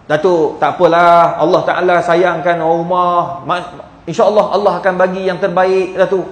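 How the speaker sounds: low, gentle reassurance; low-voiced, soothing condolence; retold consoling. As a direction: comfort a grieving friend, pitched low. Retells consoling a grieving widower.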